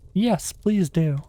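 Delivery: slow, high pleading plea. Acted. Alice pleads with the Dormouse, slow and high. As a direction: beg them sweetly and slowly. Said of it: "pleaded Alice".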